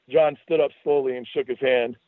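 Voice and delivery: usual speaking voice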